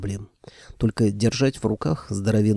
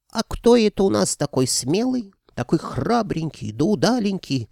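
put-on high cooing baby talk; 10 semitones higher than usual. A narrator's reading. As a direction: coo at a little child. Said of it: "засюсюкала левая голова". (сюсюканье)

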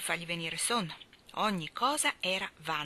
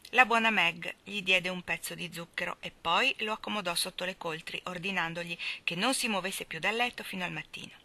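ordinary delivery

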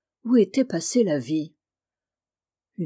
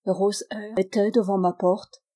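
ordinary delivery

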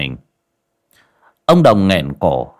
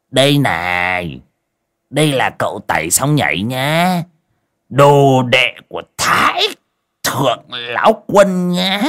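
slow, drawn-out sarcastic mimicry rising much higher; mock their boasting by outdoing it; 4 semitones higher, slower than usual. A narrator's reading. Sick old man mocking the boastful pair, slow sarcastic sing-song. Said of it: "giọng mỉa mai".

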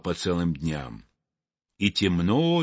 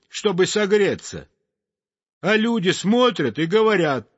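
high, lively little bird voice; chirpy sparrow voice; 8 semitones higher than usual. Acted. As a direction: chatter like a cheerful sparrow.

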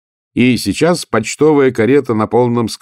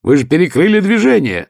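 rising shout, much higher at the end; yelling through the wind; 7 semitones higher, 4 dB louder than usual. A narrator's reading. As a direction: shout it over roaring wind. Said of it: "завопил он сквозь ветер".

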